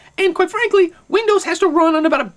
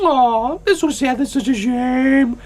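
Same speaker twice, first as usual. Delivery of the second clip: mock pity; 4 semitones lower, slower than usual.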